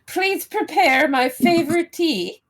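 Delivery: high, slow, laughing grand lady; imperious plummy grande dame. Pretend. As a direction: order the servant with a giggle. Grand lady ordering tea.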